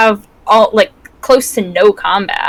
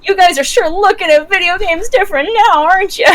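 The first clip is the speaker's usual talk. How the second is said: high-pitched, singsong mock taunt; teasing gloat; 10 semitones higher than usual. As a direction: needle the doubters in a much higher voice. Mock taunt at game critics.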